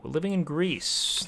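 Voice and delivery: ordinary talk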